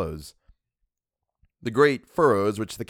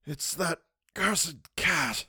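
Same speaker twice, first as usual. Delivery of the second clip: put-on high, slow, hushed old man's voice; a wounded old man, weak and bitter; Mister Wilde; 8 semitones higher, 5 dB quieter, much slower than usual. A narrator's reading. Wilde complaining to the narrator, high and hushed. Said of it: "he said".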